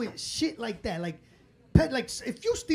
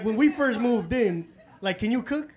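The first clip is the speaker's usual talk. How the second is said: ordinary talk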